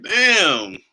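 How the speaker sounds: high, loud drawn-out exclamation; stung disbelief. Retold or imitated. Husband reacts to wife saying he isn't smart, stung and loud. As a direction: exclaim it as if freshly insulted. Retelling reaction to wife's insult.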